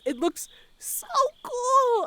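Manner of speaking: so cool drawn out high and slow; gushing awe. Real, not acted. Fan gushing about the Subtronics set, excited. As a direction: gush over it, high and stretched out.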